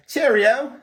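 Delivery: high, loud British cheerio. Shown as a demonstration. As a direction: call it out as a cartoonish Brit. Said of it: "For British, it's, like, really stereotype".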